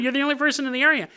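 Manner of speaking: ordinary talk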